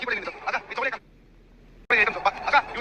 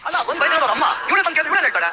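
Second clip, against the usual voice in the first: loud, raised quarrelling voice; angry shouting; 5 semitones higher, 6 dB louder than usual. Acted. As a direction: shout the accusation across the family row. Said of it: "ಕೂಗಾಡ್ತೀರಲ್ಲ ನನ್ ಮೇಲೆ".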